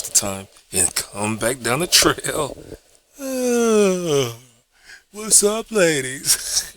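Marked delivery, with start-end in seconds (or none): 3.2-6.7 high, strained mock greeting; nervous flirt with a cracking voice; awkward pickup line. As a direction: squeak out a clumsy flirt. Time traveller hits on women.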